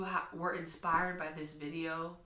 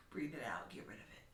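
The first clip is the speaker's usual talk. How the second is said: soft unvoiced whisper; hushed breathy instruction; 4 semitones lower, 8 dB quieter than usual. Shown as a demonstration. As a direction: whisper as you exhale. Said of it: "when you breathe out".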